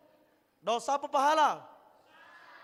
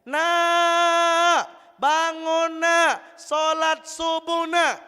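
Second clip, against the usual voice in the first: higher, louder, slow coaxing call; mother waking her child; 5 semitones higher, 6 dB louder, much slower than usual. Retold or imitated. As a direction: drag it out like a mum at dawn. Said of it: "Ibu bangunin anak bujang gadis".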